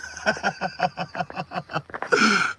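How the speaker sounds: real, helpless chuckling; cracking up. Real, not acted. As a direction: crack up at your own story. Cracks up at own story.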